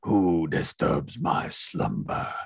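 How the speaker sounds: low, slow booming stone voice; Cave of Wonders. Retold or imitated. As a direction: rumble it out like a giant cave. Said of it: "Cave of Wonders as well".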